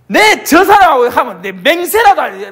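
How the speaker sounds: Peter swearing. Retold or imitated. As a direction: swear it hard.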